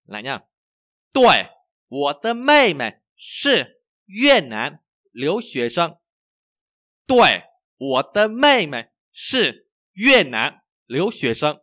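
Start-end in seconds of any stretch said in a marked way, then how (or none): 1.1-11.6 very slow, loud, word by word; language teacher's model reading. Shown as a demonstration. Teacher reads for learners to repeat, slow model reading. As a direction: exaggerate each tone, high and clearly spaced.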